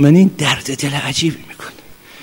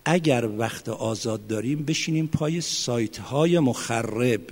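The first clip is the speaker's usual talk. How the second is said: quiet earnest warning; 2 semitones lower, 9 dB quieter than usual. Real, not acted.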